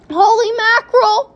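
high, loud exclamation; alarmed cry. A narrator's reading. Father hears a collision is possible, alarmed cry. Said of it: "cried Mister T V".

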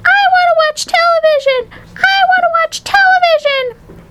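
put-on tiny boy throwing a tantrum; squeaked tantrum. A narrator's reading. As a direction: squeal it in a fit. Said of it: "he squeaked".

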